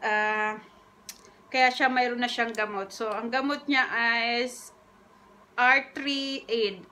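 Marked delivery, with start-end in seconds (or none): 5.6-6.9 slow, spelled-out reading; slow careful reading. Shown as a demonstration. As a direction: read the label out slowly. Reading medicine name slowly.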